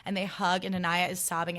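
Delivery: normal talking voice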